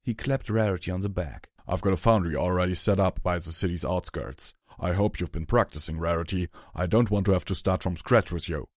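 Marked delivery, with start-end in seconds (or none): 1.7-8.7 loud, rough character voice; Hammer Chain's gruff voice. Acted. Hammer Chain explains his posting to Rarity, loud gruff voice. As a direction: explain it loudly and bluntly.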